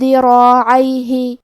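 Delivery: ذراعیہ slow after a hidden nasal tanween, را heavy; ikhfa with ghunna. Shown as a demonstration. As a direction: recite ذراعیہ with nasal hum. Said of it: "تنوین کو چھپاتے ہوئے اور غنہ کے ساتھ". (تنوین کا اخفاء غنہ کے ساتھ)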